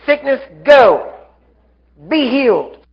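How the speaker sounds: slow, high, emphatic orders; firm command. Shown as a demonstration. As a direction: command it with authority, each order on its own. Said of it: "Commands, not request".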